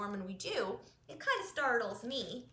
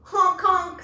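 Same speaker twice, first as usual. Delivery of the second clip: loud, higher goose honking; 6 semitones higher, 15 dB louder than usual. Acted. Reading Goose's line to kids, loud honks. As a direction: honk it out loud. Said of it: "said goose".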